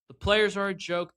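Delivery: loud, high, fed-up outburst; angry rant. Real, not acted. Fan ranting on his podcast about the players, angry. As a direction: blast the team in exasperation.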